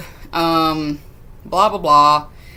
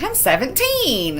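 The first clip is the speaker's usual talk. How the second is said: high mock-girlish voice; pitched-up imitation of a teenage girl; young girl; 8 semitones higher than usual. Hannah telling partygoers her age, high girlish voice.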